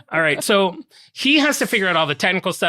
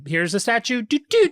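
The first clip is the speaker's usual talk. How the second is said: playful sing-song; 8 semitones higher than usual. Retold or imitated. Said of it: "I thought they're like".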